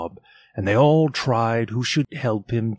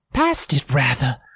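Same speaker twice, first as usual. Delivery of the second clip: voiced boy; 3 semitones higher than usual. A narrator's reading. Peter voiced.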